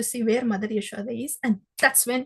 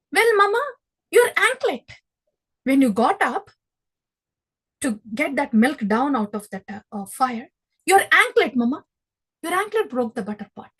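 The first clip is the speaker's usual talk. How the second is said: put-on higher, louder innocent child excuse; playful child Krishna; 3 semitones higher, 5 dB louder than usual. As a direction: sweetly shift the blame as a cheeky little boy. Child Krishna answering his mother.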